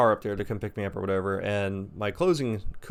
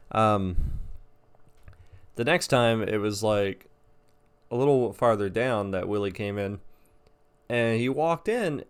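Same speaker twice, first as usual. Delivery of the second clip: usual speaking voice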